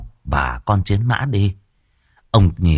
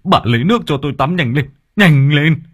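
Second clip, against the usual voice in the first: frantic; 5 semitones higher, faster than usual. A narrator's reading. Village chief begging wife for a quick bath. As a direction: rush her in a panic. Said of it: "Ông rít lên".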